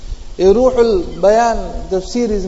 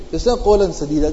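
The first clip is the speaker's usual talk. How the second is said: ordinary talk, nothing marked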